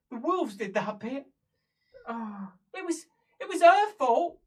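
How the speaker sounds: high protest, rising to loud; blame-shifting. Acted. Prisoner pinning the deaths on someone else, rising protest. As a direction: build to a loud accusation.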